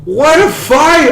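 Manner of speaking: high-pitched, stretched fight-fan cry; real excited amazement; thrilled exclamation. Real, not acted. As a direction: draw it out high, thrilled by the finish. Reacting to surprise finish.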